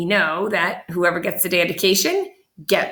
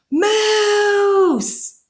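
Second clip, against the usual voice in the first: very high, loud shout; yelled Moose; 13 semitones higher, 5 dB louder than usual. A narrator's reading. Mother steps outside and yells at the moose. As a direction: cry out in shock. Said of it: "and yelled".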